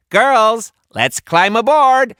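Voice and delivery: high, loud rallying yell; rally the crew to board; pirate captain yell. A narrator's reading. Captain yelling to her crew, high and loud. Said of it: "a woman pirate captain yelled".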